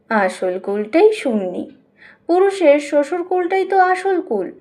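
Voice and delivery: usual speaking voice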